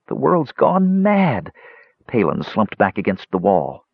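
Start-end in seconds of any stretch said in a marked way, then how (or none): 0.1-1.5 high, slow wail; despairing exclamation. A narrator's reading. Palin despairs amid the chaos.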